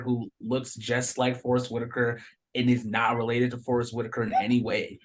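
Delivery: normal talking voice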